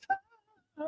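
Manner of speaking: "Ta" sung out very high and soft; pronouncing ta. Shown as a demonstration. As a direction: demonstrate "Ta" as a high floating syllable. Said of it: "you hear how I'm saying it".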